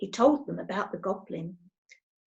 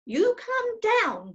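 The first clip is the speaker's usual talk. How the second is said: commanding; 11 semitones higher, slower than usual. Acted. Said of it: "commanded Moonface".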